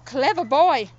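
usual speaking voice

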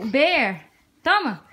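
ordinary talk, nothing marked

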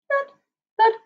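plain reading voice

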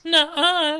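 put-on high, loud teenage contradiction; loud, high-voiced refusal; emphatic denial. Cordelia contradicts Anne.

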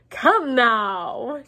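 jolly, coaxing exclamation; buoyant cry. A narrator's reading. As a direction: cry it out with a beaming smile. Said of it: "he cried, beaming around him".

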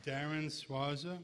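loud, slow name call. Real, not acted. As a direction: announce each name slowly to the hall. Calling inductees' names.

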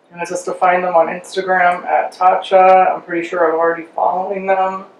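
face covered by wet mask; speaking with mask on face; talking through a sheet mask. Real, not acted.

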